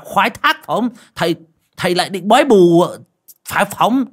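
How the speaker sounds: put-on high, loud, stammering old man in disbelief; disbelieving. A narrator's reading. Bald old man mocking the healer's diagnosis, high stammering disbelief. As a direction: fire off doubting jabs, stuttering. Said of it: "Lão hói không tin vội vàng hỏi".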